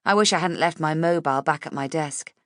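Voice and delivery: ordinary delivery, nothing marked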